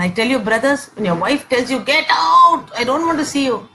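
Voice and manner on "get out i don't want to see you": loud, high burst on the first words; mock an angry wife's outburst; mock screaming. Wife ordering husband out mid-fight, mock screaming. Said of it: "she's screaming and saying".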